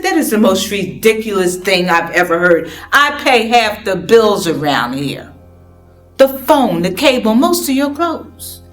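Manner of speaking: loud, indignant complaint; angry outburst. Acted. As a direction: shout back in protest. Heated argument with mother.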